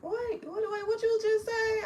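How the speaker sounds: surprised. Real, not acted. Reacting to a chat message.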